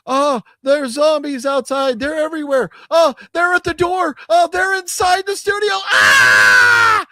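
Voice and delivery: high, loud mock panic rising to a scream; panicked radio reporter. Retelling the radio news report, a hammed-up panic rising to a shriek. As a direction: play the news reporter losing it as zombies break in.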